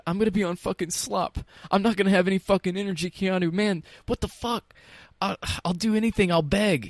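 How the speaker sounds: high, frantic pleading, pitched well up; frantic begging. Retold or imitated. Vince pleading with Keanu not to nominate him, high desperate begging. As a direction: beg him desperately, voice climbing. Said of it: "Vince begged, and he begged".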